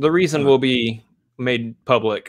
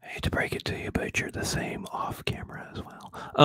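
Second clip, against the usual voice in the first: whispering; 10 dB quieter than usual. Pretend. Teasing whisper exchange.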